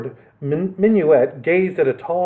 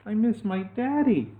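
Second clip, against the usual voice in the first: higher, softer sad voice; sad little girl; 7 semitones higher, 7 dB quieter than usual. Acted. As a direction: say it small and sad. Sad child missing father.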